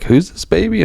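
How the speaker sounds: put-on high, bewildered old woman's question; puzzled grandma not recognizing the baby; mimicking confused grandma. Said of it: "So she's like".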